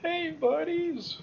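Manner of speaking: high, drawn-out hello; singsong it slowly, way up high; teasingly stretched high-pitched greeting; playful greeting. Acted. Figure greets the surprised enemies.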